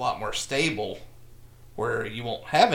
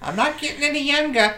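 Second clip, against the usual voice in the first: much higher, louder Boston mother voice; Boston mom impression; 13 semitones higher, 6 dB louder than usual. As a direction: sigh it like an impatient mother. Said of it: "That's a pretty good Boston mom".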